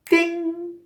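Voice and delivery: high, loud ringing "ding"; imitated bell ding. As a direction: sing out a bright-idea chime. Imitated bell as idea strikes.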